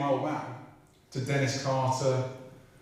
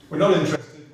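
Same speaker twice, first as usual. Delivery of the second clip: louder, insistent on NOT; firm louder repeat; 3 semitones higher, 8 dB louder than usual. Retold or imitated. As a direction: hammer the refusal home. Officials repeating their refusal, insistent.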